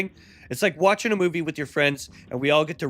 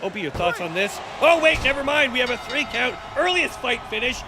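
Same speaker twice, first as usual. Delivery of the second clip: excited commentary bursting higher at the upset; wrestling play-by-play; 5 semitones higher than usual. Pretend. Commentator calling a sudden three count, excited play-by-play. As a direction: call the match like a ringside commentator.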